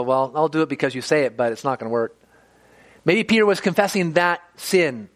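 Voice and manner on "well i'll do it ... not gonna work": reluctantly going along, doubting it works; begrudging. Retold or imitated. Peter answering Jesus about the nets, begrudging and doubtful. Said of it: "he kind of begrudgingly did so".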